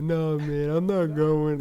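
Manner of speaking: imitating a drunk friend refusing to leave; drunk-ass voice. Friend at the party refuses to leave, mock drunk voice. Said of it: "Like, in a drunk-ass voice".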